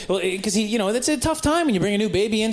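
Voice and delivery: ordinary delivery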